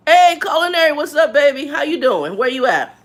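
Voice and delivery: high, loud hello to a viewer; excited greeting. Real, not acted. Streamer greeting a chat viewer, loud and excited. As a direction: call out to him with delight.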